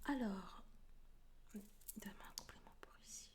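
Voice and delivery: fast, hushed ritual whisper. Real, not acted. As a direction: murmur to the cards, very softly. Whispered card-drawing ritual to deck.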